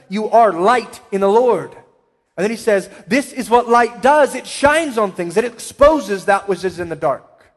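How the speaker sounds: ringing sermon voice, slowed for weight; forceful preaching. Acted. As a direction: proclaim it to the whole room. Preacher quoting the apostle forcefully.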